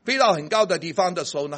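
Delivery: normal talking voice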